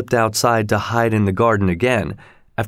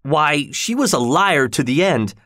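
higher, understated angry outburst; angry cry; 5 semitones higher than usual. A narrator's reading. Bereaved lover calls her a liar, mildly angry. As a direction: exclaim it with bitter indignation. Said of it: "he cried, stamping his feet".

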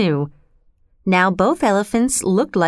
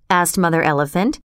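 usual speaking voice

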